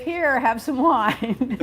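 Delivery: drawn out, deadpan delivery; slow punchline. Shown as a demonstration. Host delivering joke punchline to cohost, slow and deadpan. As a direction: stretch the wine line for the laugh. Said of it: "ultra safe".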